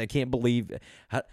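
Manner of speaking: ordinary talk, nothing marked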